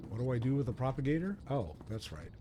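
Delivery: ordinary talk, nothing marked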